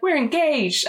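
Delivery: high-pitched starlet impression; Lena. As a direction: put on a high, swooping diva voice. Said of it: "Except she's quite high-pitched".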